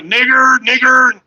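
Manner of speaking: high loud taunting yell; taunting shout. Retold or imitated. Res kids yelling a slur at him, high loud taunt. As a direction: hurl it as a taunt. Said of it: "They're like".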